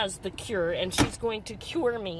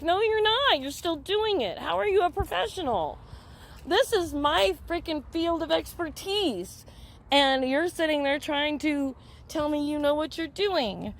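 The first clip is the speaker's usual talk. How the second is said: high, heated telling-off; retold outraged scolding; 5 semitones higher than usual. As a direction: replay losing patience with the counselor. Retold quarrel, outraged at counselor.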